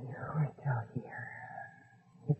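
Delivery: soft sad whisper. Acted. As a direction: whisper it alone, sadly. Mother alone, sad reflection.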